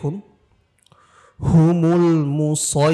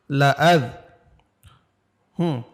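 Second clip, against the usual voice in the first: ordinary talk, nothing marked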